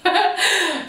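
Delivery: real emotional laugh. Real, not acted. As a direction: giggle away the emotion. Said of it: "I'm getting so emotional".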